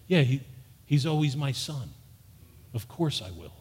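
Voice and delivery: imitating a parent near tears, barely voiced; broken parent. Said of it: "the parent in tears broken down would say".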